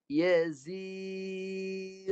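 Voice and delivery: Yazir recited with ya and ghain stopped; teacher chants Yazir, the ya held long; recited demo. Shown as a demonstration. Teacher showing students a dead ya, recited. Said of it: "Dengar saya baca".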